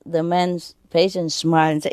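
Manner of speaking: ordinary talk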